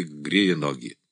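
ordinary delivery

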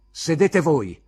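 heated pupil answering back; defiant retort. Acted. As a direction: throw the command back at the teacher. Pupil throws command back.

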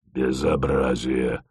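low, soft, husky reproach; reproachful husky voice. Acted. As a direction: rasp out a reproach. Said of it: "укоризненно просипела Света".

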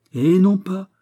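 high, loud, confident contradiction; emphatic objection. A narrator's reading. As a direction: brush the objection away forcefully. Porthos brushes off Raoul's objection. (objection vive et catégorique)

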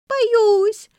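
put-on very high, loud child's screech; frightened squeal. A narrator's reading. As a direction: squeal it in panic. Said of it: "завизжала Валера".

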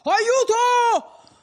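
high, loud, frantic yell; wild cry for help. A narrator's reading. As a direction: shriek for help into the night. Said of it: "quel primo sgangherato grido di Don Abbondio".

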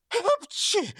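high-pitched stage sneeze; acted sneeze. A narrator's reading. Robber sneezes over broken shell, high acted sneeze. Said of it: "чихнув".

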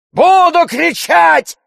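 slow, very high, loud yell. A narrator's reading. Tarantyev refusing to stop shouting, yelling. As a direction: bellow it defiantly, drawing it out. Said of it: "вопил Тарантьев".

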